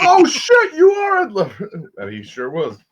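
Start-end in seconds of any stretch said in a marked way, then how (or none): 0.0-1.7 high, loud burst of mock shock; mock astonished shout. Comic retelling his shock at meeting Ed Lover, high astonished yell. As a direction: shout it in amazed recognition, pitch shooting up. Retold shocked recognition of a celebrity.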